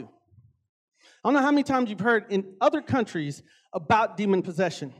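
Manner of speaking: ordinary talk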